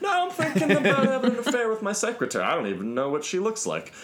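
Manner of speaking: high, spacey droning executive who isn't listening; self-important executive. Retold or imitated. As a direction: play a pompous boss lost in his own thoughts. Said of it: "in this, like, "I'm an executive and my thoughts are important".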